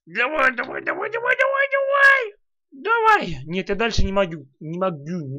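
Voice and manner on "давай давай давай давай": shouting. Real, not acted. Urging in game.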